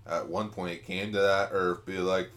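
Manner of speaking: ordinary talk, nothing marked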